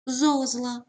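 Зозла with a high, stressed ЗО; ZO-zla, clear model of Зозла; stress on first syllable. Shown as a demonstration. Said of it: "наголос на першому складі".

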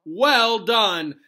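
cried out praise. A narrator's reading. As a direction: cheer the pupil on loudly. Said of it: "cried Professor Lupin".

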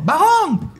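high, excited imitation of a fellow commentator; Ramón's commentary voice. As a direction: mimic the colleague urging the player on. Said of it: "eso hacía Ramón".

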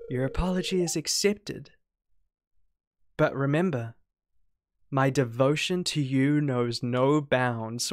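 lightly voiced devoted game character; measured, a hint of solemn devotion; devoted Penny.

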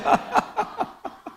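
high airy burst of laughter; real laugh after the joke; breathy laugh. Real, not acted. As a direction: laugh at your own punchline. Pastor laughs at own joke.

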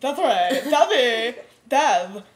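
loud goofy shouted warning; hammed-up urgent calling, rising to the last word; Bubba. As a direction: imitate a friend yelling advice from behind. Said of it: "And Bubba's like".

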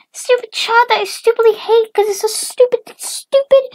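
very high, loud shrieking tirade; hammed-up, high-pitched raging; mom in a rage. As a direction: screech it like a furious cartoon mom. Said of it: "The mom, in a rage".

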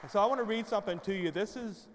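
usual speaking voice

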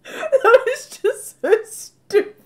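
words squeezed through tearful laughter; can barely get it out for laughing; laughing till crying. Real, not acted. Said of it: "now I'm crying".

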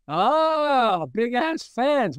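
high, slow aha; amazed realization. Retold or imitated. Friend realizing the perfect company name, high and amazed. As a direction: savour the name as it dawns.

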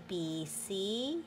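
ordinary delivery, nothing marked

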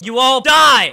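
loud hammed-up royal threat; villain king shouting his decree; mock threat. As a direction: bellow the decree like a tyrant.

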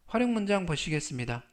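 ordinary delivery

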